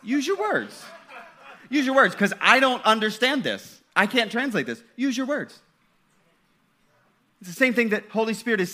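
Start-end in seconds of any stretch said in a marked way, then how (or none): 0.0-5.6 exasperated parent. Retold or imitated. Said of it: "I'm gonna say".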